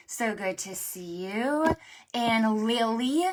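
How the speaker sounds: ordinary talk, nothing marked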